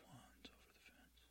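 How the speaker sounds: a hint of a slasher whispering; serial killer whisper. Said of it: "It just sounded like a serial killer".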